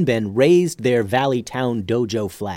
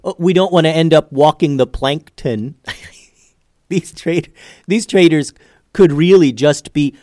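higher, louder punster breaking into chuckles; tickled by his own awful jokes; cracking up at puns; 4 semitones higher, 5 dB louder than usual. Acted. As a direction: land each pun proudly, laughing between them. Cracking himself up with fish puns.

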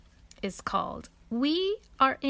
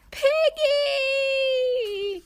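very high, loud wail of the name; crying out; 5 dB louder than usual. A narrator's reading. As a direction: cry out the friend's name in despair. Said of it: "cries Gerard".

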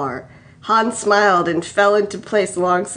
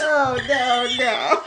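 plain reading voice

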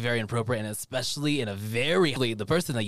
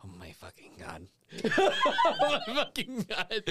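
laughing disbelief; 10 semitones higher than usual. Real, not acted. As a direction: crack up in disbelief. Disbelief after NPC leaves.

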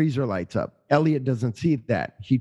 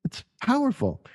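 much higher, earnest protest; higher pleading to wife; mock defensive plea; 8 semitones higher than usual. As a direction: insist to the wife it's powerful. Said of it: "And I'm like".

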